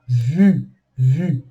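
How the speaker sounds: "vu" with a tight, rounded French U; pouted French U. Shown as a demonstration. Teacher showing the pout nearer U, pronunciation demo. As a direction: pout towards U on vu. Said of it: "mais pro lado do".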